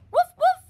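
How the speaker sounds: high, very loud woof; barking woof. Shown as a demonstration. Barking for the kids. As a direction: bark like a dog. Dog sound for children.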